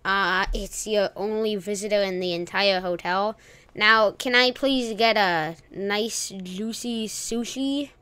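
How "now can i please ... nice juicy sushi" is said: slow, silly put-on voice; stupid voice. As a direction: drag it out in a goofy voice. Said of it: "that's a stupid voice".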